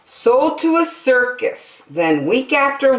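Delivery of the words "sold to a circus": usual speaking voice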